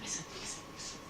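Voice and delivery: very soft, whispered urging; confidential hushed hint. Retold or imitated. Russian girl secretly helping him past her supervisor, hushed. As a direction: imitate a furtive whisper to get attention.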